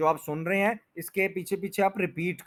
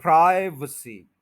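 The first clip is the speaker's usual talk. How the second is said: Privacy as "PRAAI-vuh-see", loud start; stress on first syllable; 6 dB louder, slower than usual. Shown as a demonstration. Teacher joins the syllables, stressed start. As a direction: hit PRAAI and swallow the middle vowel of privacy. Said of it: "stress जो है वो first syllable पे है".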